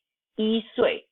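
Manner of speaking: 一岁 with 一 held high and level, no rise; mispronounced tone. Shown as a demonstration. Teacher imitates learners still getting it wrong, unchanged tone. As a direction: keep 一 in 一岁 high in unchanged first tone. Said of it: "I know that sounds wrong".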